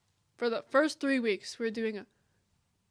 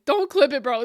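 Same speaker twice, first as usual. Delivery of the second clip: louder, pleading; loud plea; 3 semitones higher, 9 dB louder than usual. Real, not acted. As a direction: beg them loudly. Begging chat not to clip.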